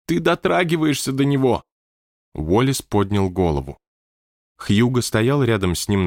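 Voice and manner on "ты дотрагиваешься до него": usual speaking voice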